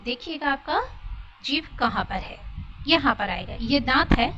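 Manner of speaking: ordinary talk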